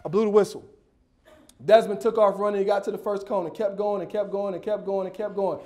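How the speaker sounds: ordinary delivery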